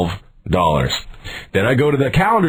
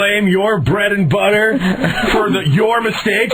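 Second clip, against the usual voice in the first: high, incredulous scolding; raised-pitch indignant challenge; acted outrage; 9 semitones higher than usual. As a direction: re-enact an indignant customer turning on the employee. Acted outraged quarrel with store employee.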